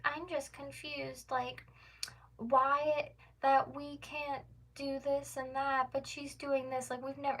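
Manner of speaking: high, soft, slow put-on voice; mock confusion. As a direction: imitate her acting baffled, dragged out and high. Imitates lady claiming confusion.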